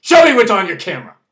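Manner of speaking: loud, fast demanding officer; demanding cop. Retold or imitated. Officer demanding to see the camera, loud and pushy. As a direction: bark out the order to show the camera. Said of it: "abruptly pushing towards me, saying".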